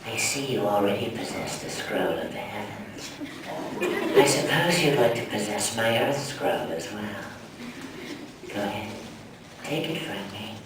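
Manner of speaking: low, quiet, snaky villain voice; Orochimaru voice. Acted. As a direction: hiss it like a sinister snake villain. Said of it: "he was kinda snaky".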